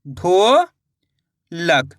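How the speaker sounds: ढोलक stretched very long and slow; teacher blending syllables slowly for kids; slow blended word. Shown as a demonstration. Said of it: "तो मिलके बन जाएगा".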